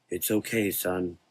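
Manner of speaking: soft, coaxing father voice. Acted. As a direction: coax him gently. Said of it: "the dark man coaxed".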